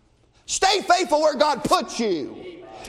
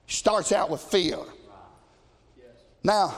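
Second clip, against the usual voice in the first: normal talking voice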